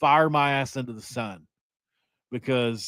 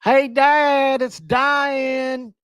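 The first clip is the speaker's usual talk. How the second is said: put-on much higher, hollered kid voice; nine-year-old kid calling Dad; 12 semitones higher, 4 dB louder, much slower than usual. Nine-year-old calling dad to the phone, high mock yell. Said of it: "And I'm like, uh".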